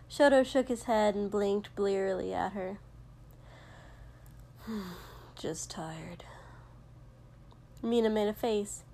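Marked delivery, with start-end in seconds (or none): 4.6-6.2 hushed, weary reply; tired sigh. A narrator's reading. Sleepy boy brushing off his friend at breakfast, hushed and tired. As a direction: half-whisper it low, worn out.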